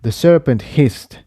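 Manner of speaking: usual speaking voice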